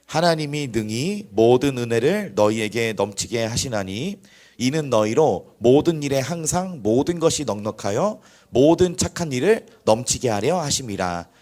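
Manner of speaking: loud, slow congregational reading; emphatic recitation, stretched phrase by phrase; scripture reading. Real, not acted. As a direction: lead the verse aloud, projected and measured. Reads scripture aloud for congregation.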